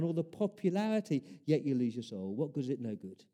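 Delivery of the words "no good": normal talking voice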